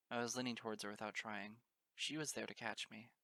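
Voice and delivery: ordinary delivery, nothing marked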